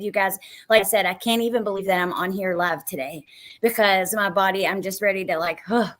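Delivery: usual speaking voice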